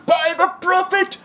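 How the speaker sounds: high, desperate pleading genie. Acted. Trapped genie begging the fisherman, high and desperate. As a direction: promise anything to get out, high-pitched.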